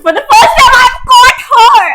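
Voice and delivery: triumphant shout. Real, not acted. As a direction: crow over catching her mistake. Triomphe d'avoir pris l'erreur.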